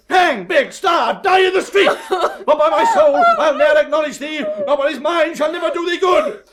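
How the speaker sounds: high, loud, furious tirade; enraged shouting. Acted. Father disowning his daughter, enraged shouting. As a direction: shout it at the top of your voice.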